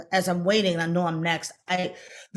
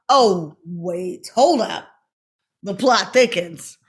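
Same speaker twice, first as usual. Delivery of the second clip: mock dramatic; 6 dB louder, much slower than usual. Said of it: "I'm like".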